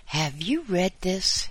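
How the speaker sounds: high, loud squeaky question. Acted. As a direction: squeak it out excitedly. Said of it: "he squeaked".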